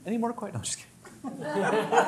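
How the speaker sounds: high, laughing tease; laughing joke. Real, not acted. Teacher teasing students about ending early, laughing.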